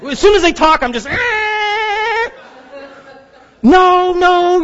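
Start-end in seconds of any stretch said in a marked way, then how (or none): 0.8-2.3 frustrated cry. Retold or imitated. Finally reaching a person. 3.6-4.6 frustrated yell. Retold or imitated. Yelling at phone agent.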